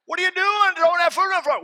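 loud, high scolding yell; mock scolding. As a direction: yell at the kid like a stern parent. Scolding kid throwing food.

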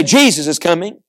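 ordinary talk, nothing marked

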